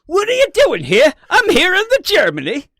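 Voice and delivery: loud, high, hammed-up German; mock German accent. Podcaster showing co-host his German, loud mock German accent. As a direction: belt out a badly faked German accent. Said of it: "Here's my German".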